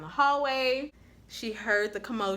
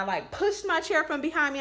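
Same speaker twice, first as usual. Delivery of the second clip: usual speaking voice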